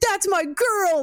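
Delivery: high, proud cheering father; proud dad. Retold or imitated. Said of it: "the, like".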